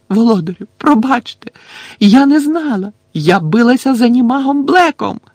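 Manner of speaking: high, loud, desperate begging; sobbing plea. A narrator's reading. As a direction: plead frantically as if in tears. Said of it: "ридаючи". (відчайдушне слізне благання)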